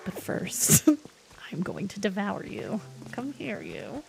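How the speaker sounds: hushed mock menace to a puppy; playfully creepy, low and soft; half-whispered doting threat; imitating the vet. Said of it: "she was like".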